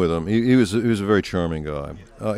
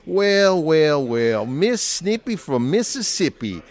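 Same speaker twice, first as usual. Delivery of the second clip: slow, higher-pitched mock Southern drawl; put-on Southern accent; 6 semitones higher, slower than usual. Husband teasing his Southern wife in a put-on Southern accent. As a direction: tease in a drawn-out Southern accent. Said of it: "She had a Southern accent".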